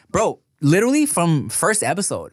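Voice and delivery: usual speaking voice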